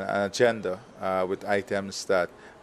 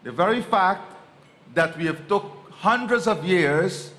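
forceful speech; 7 semitones higher, 5 dB louder than usual. Real, not acted. President pressing the case for reparations, forceful rallying speech. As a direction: drive each phrase home to the hall.

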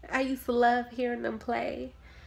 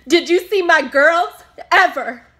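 loud, raised-pitch outburst at the doctor; angry confrontation; 5 semitones higher, 10 dB louder than usual. Acted. Bereaved mother challenging her doctor about that day, angry. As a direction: demand answers, voice climbing with anguish.

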